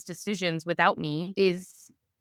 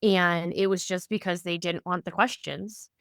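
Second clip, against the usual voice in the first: ordinary talk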